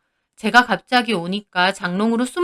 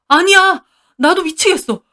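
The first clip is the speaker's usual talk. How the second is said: higher, louder frantic denial; desperate husband; 8 semitones higher, 6 dB louder than usual. Acted. Husband denies to furious wife, frantic higher voice. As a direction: cry out that it isn't so.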